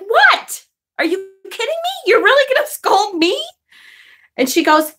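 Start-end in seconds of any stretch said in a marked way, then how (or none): 0.1-3.4 sharp, much higher disbelieving snap; indignant retort. Retold or imitated. Daughter snapping back at her scolding mother, high and indignant. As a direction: fire back at being scolded. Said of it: "And I'm like".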